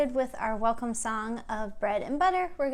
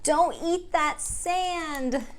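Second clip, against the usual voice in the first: slow, sing-song picture book reading; reading to children; much slower than usual. Acted. Teacher reads a picture book aloud to the kids. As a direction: read it gently to little ones.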